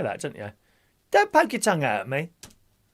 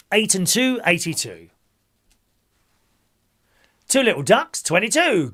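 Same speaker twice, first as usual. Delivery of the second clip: bingo caller; 4 semitones higher, 5 dB louder than usual. Acted. Calls bingo numbers.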